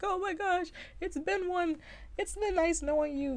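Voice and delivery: high, hammed-up terrified goodbye; mock scary-movie fear. Roommates huddled in fright after she rises from bed, mock terror. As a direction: squeal it like a doomed horror-movie couple. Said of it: "like in scary movies, huddled to each other".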